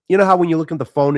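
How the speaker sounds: ordinary talk, nothing marked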